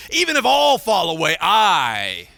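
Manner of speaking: Peter insisting, swearing loyalty; proud disciple declares loudly to Jesus; defiant protest. Acted.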